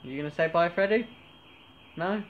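high, loud pet talk. Real, not acted. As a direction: coax the dog sweetly. Asks the dog to say bye.

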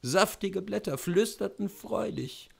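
usual speaking voice

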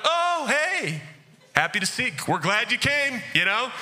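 a high, far-off, overly bright hello; fake friendly. Greeting a person you resent at church, fake and overly cheery. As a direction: greet someone with fake cheer to make them feel weird. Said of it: "I'm gonna bug eye him like".

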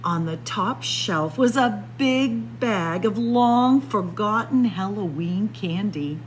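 ordinary delivery

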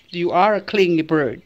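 ordinary talk, nothing marked

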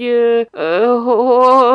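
loud, halting hesitation sounds; stammering. A narrator's reading. Boy fumbling for an excuse, stammering. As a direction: stumble and stick on the word.

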